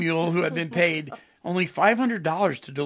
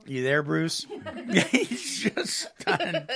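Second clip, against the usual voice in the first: laughing; 5 semitones higher, much slower than usual. Real, not acted. Host laughing at cohost.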